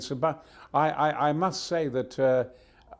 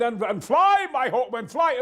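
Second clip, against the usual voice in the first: high, loud, rousing command; hammed-up war cry; heroic battle cry; 11 semitones higher, 6 dB louder than usual. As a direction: bellow orders to winged warriors. Mock battle cry for sat nav.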